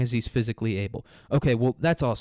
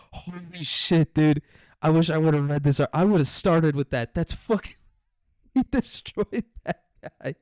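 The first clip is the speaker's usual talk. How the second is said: amazed laughing; 4 semitones higher than usual. Real, not acted. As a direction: crack up at the savage burn.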